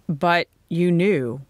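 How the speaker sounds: slow, puzzled protest; perplexed. A narrator's reading. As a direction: protest slowly, taken aback. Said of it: "He looked perplexed".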